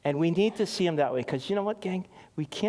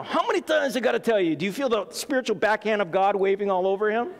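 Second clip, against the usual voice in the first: high, quick exasperated scolding; mock scolding; 6 semitones higher than usual. Preacher acts out God scolding Abram, mock exasperated scolding. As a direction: scold like a fed-up parent, pitched up.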